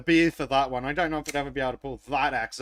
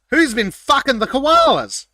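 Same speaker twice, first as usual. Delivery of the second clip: loud, high, slow mock bellow; mock shout; 8 semitones higher, 10 dB louder than usual. George quoted yelling about the koalas, a loud mock bellow. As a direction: bellow it like an outraged drill sergeant. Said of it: "George says".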